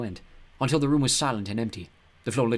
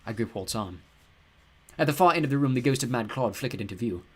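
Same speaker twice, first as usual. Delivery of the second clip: plain reading voice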